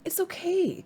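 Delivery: a hint of a kindly badger; comforting Badger. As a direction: soothe the little hedgehog as Badger. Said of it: "comforts Badger".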